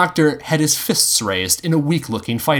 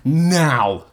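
raised, drawn-out command; urgent, raised single-word order; shouting; 4 semitones higher than usual. A narrator's reading. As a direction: bark it as one urgent order. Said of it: "Tiger shouted".

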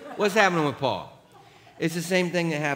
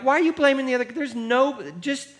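mock rebuke; 12 semitones higher, 6 dB louder than usual. Friend rebuking in retold story.

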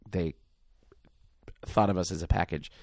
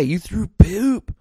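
slow, high movie-quote impression with stretched words; high character voice; 12 semitones higher, 5 dB louder, much slower than usual. Co-host quotes the actor's big movie line, high impression. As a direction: draw out the outraged line in a high voice. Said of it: "'Cause what does he say?".

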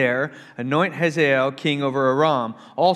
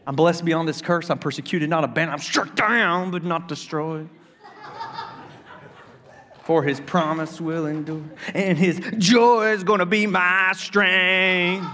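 impassioned worship lyrics; 5 semitones higher, 4 dB louder than usual. Retold or imitated. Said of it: "worship song lyrics quoted".

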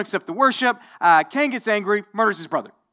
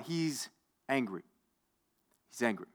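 hushed emphasis; 9 semitones lower, 14 dB quieter than usual. Acted. Dramatic hushed repeat.

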